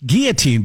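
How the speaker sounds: put-on guillotine with silent L's, "GEE-uh-teen"; correct pronunciation. Shown as a demonstration. Host naming the contest prop, guillotine as GEE-uh-teen. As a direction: say guillotine French-style, hard g and no l. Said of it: "it's guillotine".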